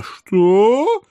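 high, loud, startled squeak; shocked. Acted. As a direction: yelp it in disbelief. Elf's shocked reaction.

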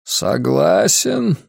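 put-on slow wheezing old man, heavy breaths; labored breathing, words dragged out; breathless old speaker. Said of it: "Дышал старик тяжело, со свистом".

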